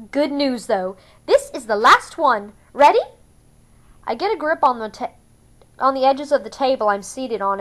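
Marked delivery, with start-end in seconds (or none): 0.1-3.0 bright fussy Capitol stylist; Capitol accent. A narrator's reading. Vienna warns Katniss of the last strip, Capitol accent.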